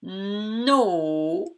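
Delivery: "no" with a long n and long o; drawn-out syllable. Shown as a demonstration. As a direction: stretch "no" slowly so both sounds are heard. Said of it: "Dans la syllabe no".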